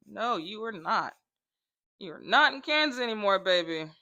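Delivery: ordinary talk, nothing marked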